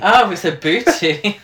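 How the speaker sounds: loud, slow, teasing mishearing; mock correction. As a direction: draw it out to tease.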